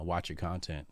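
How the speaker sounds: normal talking voice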